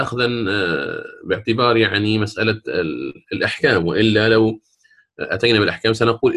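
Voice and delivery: normal talking voice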